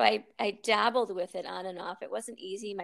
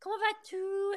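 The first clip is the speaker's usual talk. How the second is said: much higher, put-on French charmer voice; old French man; 13 semitones higher, 4 dB quieter than usual. The old Frenchman in class greeting her, high and sing-song. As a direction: coo it like a doting old Frenchman. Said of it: "he'd be like".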